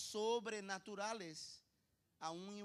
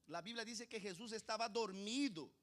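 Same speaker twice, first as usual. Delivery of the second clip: ordinary talk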